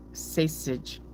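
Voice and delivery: "Sausage" with an "ay" vowel, "saysage"; trying out sausage. Shown as a demonstration. Reader testing how the word sounds, "saysage". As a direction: say Sausage as "SAY-sij", softly. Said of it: "it would sound long".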